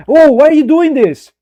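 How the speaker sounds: high, loud mock mom whining; imitating mom. Son imitates his mother's complaint, high and whiny. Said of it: "And if she were like".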